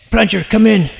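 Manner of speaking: loud, high radio call. Real, not acted. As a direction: shout into the radio for backup. Calling on radio for help.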